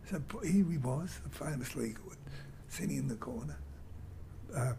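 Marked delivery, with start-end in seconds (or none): none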